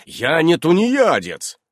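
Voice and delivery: loud, slow, indignant protest; offended. A narrator's reading. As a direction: defend yourself, stung. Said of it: "обиделся Иннокентий".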